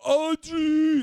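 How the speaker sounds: put-on huge high drunken bellow; Rocky-style yell. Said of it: "Like he's Rocky".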